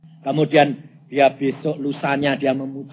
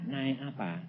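soft, lowered question; gentler question; 3 semitones lower, 9 dB quieter than usual. Retold or imitated. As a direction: ask it quietly of a hesitant wife. Question repeated softly after wife hesitates.

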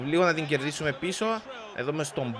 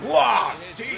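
raised-pitch imitation of a celebration shout; imitating goal celebration; 9 semitones higher, 5 dB louder than usual. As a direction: mimic the player's celebration cry. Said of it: "θα κάνει το δικό του".

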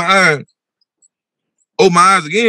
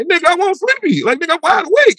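much higher, incredulous retold protest; high-pitched protest; 12 semitones higher than usual. Man insisting he is not dreaming, high-pitched protest. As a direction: protest in a squeaky, disbelieving pitch.

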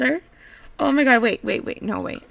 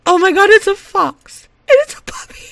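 loud, high squeal climbing higher; excited squeal; 9 semitones higher, 9 dB louder than usual. Real, not acted. Gamer finding fox and puppy outfits, tearful excited squeal. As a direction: squeal with mounting delight.

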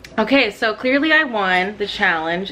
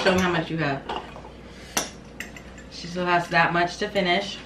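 ordinary talk, nothing marked

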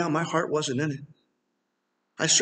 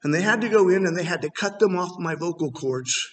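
usual speaking voice

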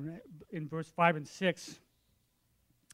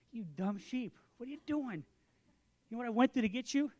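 mock exasperated scolding, pitched higher; ticked off; 6 semitones higher than usual. Tired shepherd to the lost lamb, mildly ticked off. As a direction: scold with mild, put-on annoyance. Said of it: "I'd be a little ticked off".

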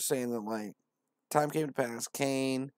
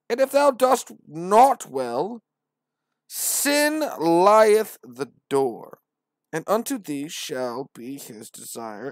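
much higher, louder, very slow preacher voice; grand scripture recital; 5 semitones higher, 11 dB louder, slower than usual. Acted. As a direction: proclaim every word.

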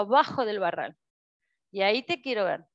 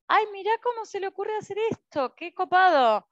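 high, delighted gasp of wonder; a bright amazed aside; amazed exclaim; 8 semitones higher than usual. Retold or imitated. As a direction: marvel at a clever idea. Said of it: "me asombro y digo".

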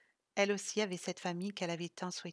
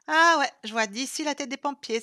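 loud, higher, joking scoff; mocking; 6 semitones higher, 12 dB louder than usual. Sylvie joking to cheer up Isa, mocking. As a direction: lighten the mood with a jeer. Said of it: "se moqua Sylvie".

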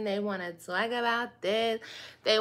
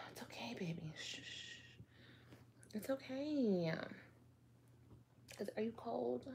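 much softer shushing whisper; hushing whisper; 16 dB quieter than usual. Pretend. Hushing the pretend baby off camera, soft whisper. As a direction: soothe the baby so it stays asleep.

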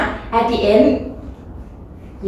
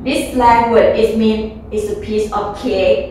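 normal talking voice

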